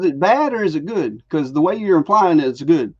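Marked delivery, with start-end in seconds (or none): none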